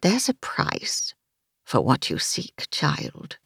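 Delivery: put-on slow, whispery old witch; serious crone. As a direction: rasp it slowly like an ominous crone. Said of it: "the crone said in a serious tone".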